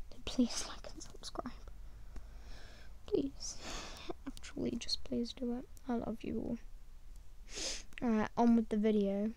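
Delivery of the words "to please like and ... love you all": soft pleading whisper. Real, not acted. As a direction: whisper the plea softly. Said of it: "unvoiced whispered plea".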